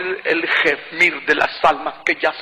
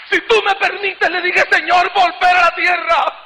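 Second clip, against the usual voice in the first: preaching at full force; 5 semitones higher than usual. Acted. Preacher pleading with the Lord to return, full force preaching. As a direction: build it up like a revival sermon climax. Testimony builds to pleading before God.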